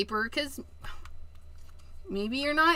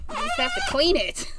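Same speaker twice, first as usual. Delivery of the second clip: much higher, strained and exasperated; exasperated squeak; 4 dB louder than usual. Real, not acted. Crafter exasperated at cleaning her sticky mat. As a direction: push it out after a tired sigh.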